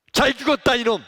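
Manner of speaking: loud, high sneering gloat; gloating shout. Acted. Pharisees gloating over dead Jesus, loud sneer. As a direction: spit it out in triumph.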